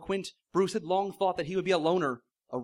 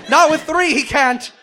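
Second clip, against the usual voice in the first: higher, much louder insistent shout; desperate shout; 8 semitones higher, 10 dB louder, slower than usual. Acted. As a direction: insist over the chaos at full voice. Quint insisting mid-struggle.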